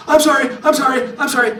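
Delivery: high, loud mock begging; frantic apologizing. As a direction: imitate a caught thief pleading over and over. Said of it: "he's apologizing the whole way".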